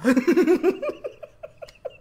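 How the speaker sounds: shrieking laugh, high and loud; loud high laughter. Real, not acted. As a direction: burst out laughing loudly.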